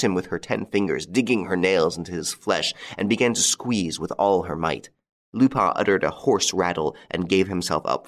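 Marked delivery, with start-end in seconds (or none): none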